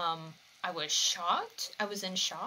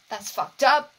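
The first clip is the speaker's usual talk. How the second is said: outraged; 5 semitones higher, 11 dB louder than usual. Real, not acted. Outraged reaction to anime.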